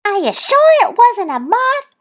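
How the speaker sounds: very high little-duck voice; Thistle's questioning voice. A narrator's reading. Little duck challenging Goose, high questioning voice. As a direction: question it in a high, pert pitch. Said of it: "Thistle asked".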